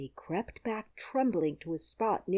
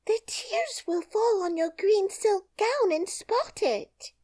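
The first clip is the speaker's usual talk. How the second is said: put-on much higher, fussy girl's voice; green girl; 4 dB louder than usual. A narrator's reading. The green girl warning Dorothy about her gown, high and fussy. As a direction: fret lightly about the silk gown.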